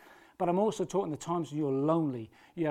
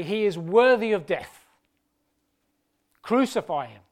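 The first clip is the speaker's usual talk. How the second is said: crowd's demand; 3 semitones higher, 8 dB louder than usual. Acted. Crowd condemns Jesus at trial.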